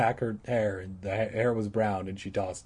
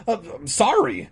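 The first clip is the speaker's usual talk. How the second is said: plain reading voice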